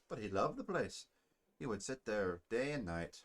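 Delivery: put-on Scottish-accented dwarf soldier; amateur Scottish brogue for a dwarf. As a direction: speak like a Braveheart Scot. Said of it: "Scottish accent".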